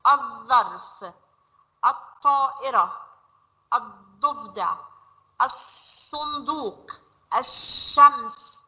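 الظرف، الطائرة، الضفدع، الصندوق، الشمس said slowly, lam assimilated and the next letter doubled; slow, deliberate sun-letter words; silent lam. Shown as a demonstration. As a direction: model each word slowly for children. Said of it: "بصوا نطقناها ازاي؟".

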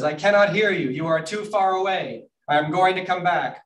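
high-pitched call across a distance; shouting back. Acted. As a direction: holler back, declaring every word. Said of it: "they have to shout at each other".

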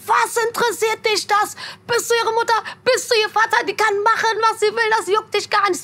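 put-on high-pitched telling-off of the boy; mother scolding. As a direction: put the rude caller in his place. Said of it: "Dann sagt meine Mutter so". (die Mutter weist den Jungen am Telefon hoch und bestimmt zurecht)

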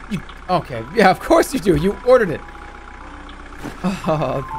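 ordinary talk

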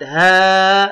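"ها" with the aa held long; long alif vowel. Shown as a demonstration. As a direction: stretch "ها" out slowly on one level note. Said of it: "نقرأ مع المد". ("ها" بمد الألف)

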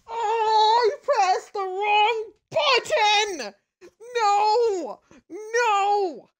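high, drawn-out laughing sob; half laughing, half crying at the screen; slow, strained laugh-cry of disbelief. Real, not acted. Wrong button pressed, character dies.

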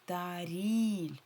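ТАРІЛЬ slowly, second syllable stretched and stressed. Shown as a demonstration. Teacher repeats where the stress falls, І stronger. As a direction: hold the I in ТАРІЛЬ. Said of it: "вимовляємо І сильніше".

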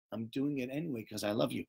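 soft parental confession of love; tender reassurance. Acted. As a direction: drop to a gentle hush. Parent gently reassuring a hurt child.

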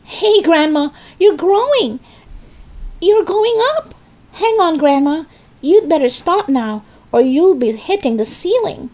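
high-pitched, urgent calling out; alarmed shout. A narrator's reading. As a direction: shout a startled warning up at her. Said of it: "gave a jump and shouted".

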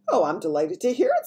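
high, loud gracious pleasure; smiling delight. A narrator's reading. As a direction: say it with a delighted smile. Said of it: "still smiling".

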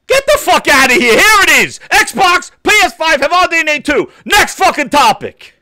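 yelling at the top of his lungs; furious shout. Real, not acted. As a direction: blow up in a furious rant. Ranting about console research.

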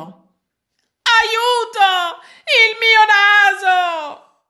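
high, loud panicked scream for help; terrified cry. A narrator's reading. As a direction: shriek in horror at the mirror. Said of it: "gridò". (grido di terrore acuto e forte)

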